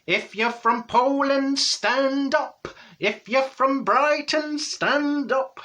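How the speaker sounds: high, slow, drawn-out mock Yorkshire; bad Yorkshire accent. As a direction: call it out high in a badly faked Yorkshire accent. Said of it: "not sure what part of Yorkshire that is".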